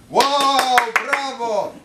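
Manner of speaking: high, loud delighted cheer; cheering women. Retold or imitated. The women cheer the priest's demand, high and loud. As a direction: shout bravo in delight.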